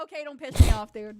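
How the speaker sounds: loud mock threat; mock angry. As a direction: snap it out loud.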